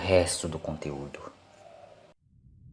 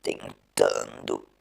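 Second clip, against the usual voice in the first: much lower, very slow, halting machine-like voice; a man losing his mind to the machine; deep, slow, broken cyborg drone; nervous, turning Cyberman; 10 semitones lower, much slower than usual. Acted. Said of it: "e nervoso ele disse".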